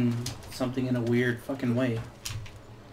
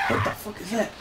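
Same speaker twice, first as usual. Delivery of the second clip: surprised; 10 semitones higher, 7 dB louder than usual. Real, not acted. Sudden enemy appears.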